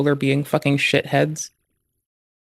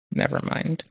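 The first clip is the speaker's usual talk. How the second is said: barely voiced whisper, much softer; 8 dB quieter than usual. Real, not acted. Streamer repeats his disappointment to himself, whispering. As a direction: breathe it out as a whisper.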